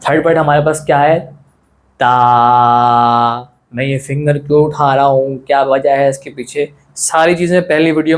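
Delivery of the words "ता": ता with the vowel held long on a level note; stretched letter. Shown as a demonstration. Teacher reads the third letter, ता held long and level. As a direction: draw ता out in a sustained monotone. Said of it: "आपको पढ़ के बताऊँगा".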